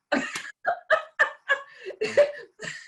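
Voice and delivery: laughs out high and long; high burst of laughter. Real, not acted. Telling the soap story, bursting into high laughter. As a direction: cracks up at her own story.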